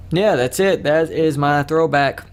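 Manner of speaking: normal talking voice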